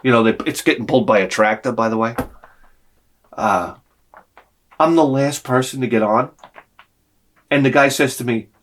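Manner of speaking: ordinary delivery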